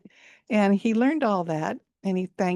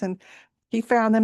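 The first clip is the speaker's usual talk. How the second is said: usual speaking voice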